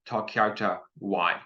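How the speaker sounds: Tá cearta why, said slowly with why like the letter Y; slow model Irish. Shown as a demonstration. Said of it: "is how you would say this".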